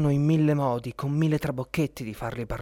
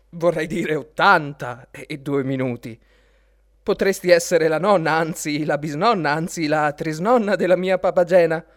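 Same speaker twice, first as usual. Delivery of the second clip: louder, building insults, stress on "bisnonna" and "trisnonna"; Papageno's indignant thought; 4 semitones higher, 6 dB louder than usual. Acted. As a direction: pile it on, each word a bigger jab. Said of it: "pensò Papageno".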